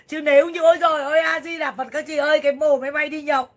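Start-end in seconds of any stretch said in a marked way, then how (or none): 0.1-2.4 loud, high burst of alarm; alarmed exclamation. Real, not acted. Streamer realizing she narrowly escaped, loud alarmed cry. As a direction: blurt it out with a jolt of fear.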